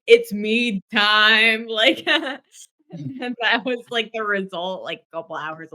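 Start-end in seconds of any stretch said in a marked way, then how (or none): 0.1-1.7 slow, loud chant of the words; mock party cheer. Friend retelling the band's drinking cheer, slow and loud. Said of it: "everybody was like".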